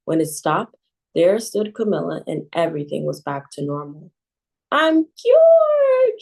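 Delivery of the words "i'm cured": high, loud cry of relief; joyful shout. A narrator's reading. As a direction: shout it out in delight. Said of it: "she shouted".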